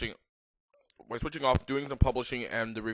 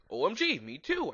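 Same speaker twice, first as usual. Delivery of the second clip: happy; 7 semitones higher than usual. Acted. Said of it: "I replied happily".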